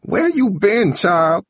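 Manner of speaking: put-on high old-woman scolding; high-pitched cross grandmother voice; angry grandma shout. A narrator's reading. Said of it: "Grandma shouted angrily from the couch".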